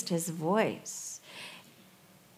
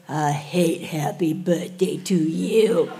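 put-on slow, lower, mumbled toddler voice; toddler whisper; 3 semitones lower, slower than usual. Son at his birthday, a sulky toddler mumble. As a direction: mumble like a sulky three-year-old. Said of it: "He goes".

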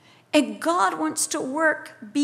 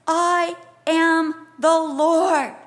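slow, loud, word-by-word declaration; divine proclamation; 2 semitones higher, 6 dB louder, much slower than usual. Acted. God declaring himself to Jeremiah, slow loud proclamation. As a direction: proclaim it weightily, each word set apart. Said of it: "He says".

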